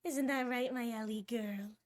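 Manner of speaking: usual speaking voice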